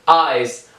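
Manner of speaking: "آیس" opened on aa gliding to ee; teacher's demo of "آیس" starting on open aa. Shown as a demonstration.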